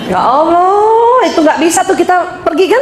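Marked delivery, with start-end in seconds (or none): 0.1-1.2 long drawn-out exclamation, a little high; mock exasperation. Wife lamenting over a sick husband, mock exasperated.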